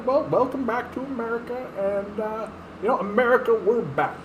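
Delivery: lightly voiced presidential address; politician voice. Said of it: "Biden-style return speech".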